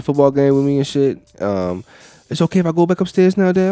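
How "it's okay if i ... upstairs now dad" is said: daughter voice. Retold or imitated.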